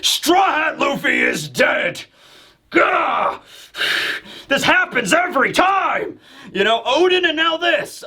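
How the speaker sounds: high-pitched, roaring dragon proclamation; hammed-up monster bellow; booming dragon voice. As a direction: announce it to the whole island as a huge dragon. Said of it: "It's just giant booming dragon voice".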